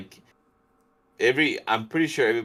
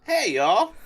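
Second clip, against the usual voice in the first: high put-on Southern greeting; Southern accent; 14 semitones higher, slower than usual. Shown as a demonstration. Co-host taking another turn at the accent, high Southern voice. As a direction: pitch it up into a playful Southern hello. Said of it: "you said it good".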